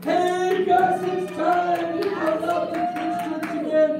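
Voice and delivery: high, loud fake cheer; forced happy. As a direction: cover the bad news with a big bright welcome. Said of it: "look happy, look happy".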